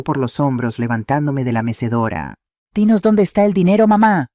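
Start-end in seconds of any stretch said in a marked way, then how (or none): 2.7-4.3 high, loud demand; raised voice, pressing for an answer; angry shout. A narrator's reading. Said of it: "gritó sacudiéndome con fuerza".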